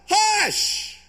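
high, loud scolding hush; sharp hush. Acted. Crowd hushing the beggar, high and sharp. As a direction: shut him up.

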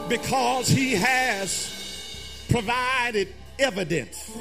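ordinary talk, nothing marked

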